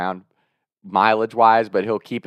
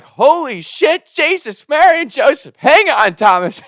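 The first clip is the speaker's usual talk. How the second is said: much higher, slow shrieking grandma; panicked grandma; 5 dB louder, slower than usual. Retold or imitated. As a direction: shriek like a terrified grandmother braking. Grandma in near crash.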